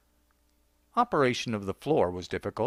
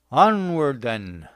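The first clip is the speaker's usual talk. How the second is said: put-on loud drunken proclamation; pronouncing grandly; 6 dB louder, much slower than usual. A narrator's reading. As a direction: declare it like a grand exit. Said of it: "Bloomfield pronounced".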